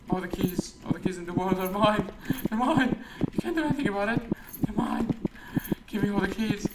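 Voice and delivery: high, hammed-up possessive gloating; mock key greed. Gamer claiming every key as theirs, mock-greedy high voice. Playing someone obsessed with keys.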